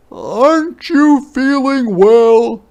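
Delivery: high, loud, slow question; a father checking on his child; high worried father; Papa concerned. Acted. Papa voiced.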